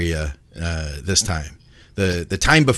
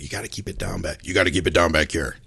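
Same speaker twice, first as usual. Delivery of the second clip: lowered, softer voice; retold hushing; 4 semitones lower, 6 dB quieter than usual. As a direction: quietly tell someone to keep it down.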